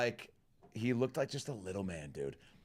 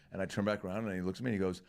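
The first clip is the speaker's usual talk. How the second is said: ordinary delivery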